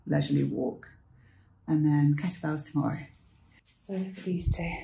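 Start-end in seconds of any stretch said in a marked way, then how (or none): none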